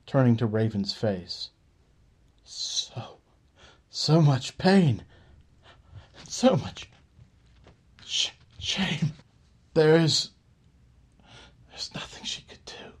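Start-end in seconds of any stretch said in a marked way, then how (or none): 2.4-12.9 broken breathy gasps, slow and halting, fading to a whisper; overwhelmed by the pain he senses, gasp it out. Acted. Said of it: "he quickly gasped".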